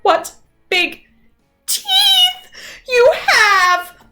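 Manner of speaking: Little Red finally scared; alarmed. Acted. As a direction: stretch out teeth in fright. Frightened girl's climax line.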